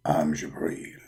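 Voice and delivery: very low, hushed angel voice; Jibreel's voice. Acted. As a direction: speak deep and hushed. Jibreel answers the call.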